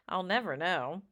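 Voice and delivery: ordinary delivery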